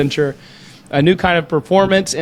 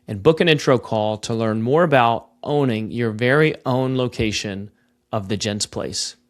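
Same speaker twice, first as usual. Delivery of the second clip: smooth, measured sponsor read; 3 semitones lower, 4 dB quieter than usual. Acted. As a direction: read it like a radio spot.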